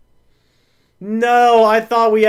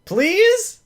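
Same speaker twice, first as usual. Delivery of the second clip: high plea; 11 semitones higher than usual. Real, not acted. Begging refs.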